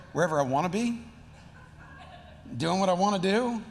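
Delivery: lightly voiced evasive teen; sulky teenager. Teenager answering where they have been, sullen. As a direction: answer like a teenager dodging the question. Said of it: "like, a teenager response".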